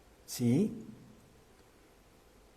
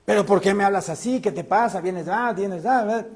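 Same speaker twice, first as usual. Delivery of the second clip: fast, higher-pitched mock angry comeback; raised-tone retort; 5 semitones higher than usual. Preacher acts out answering harsh words in kind, irritated. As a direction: snap back in a mock quarrel. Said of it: "Suben el nivel de, de tono".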